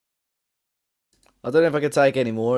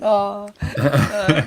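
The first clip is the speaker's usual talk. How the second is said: usual speaking voice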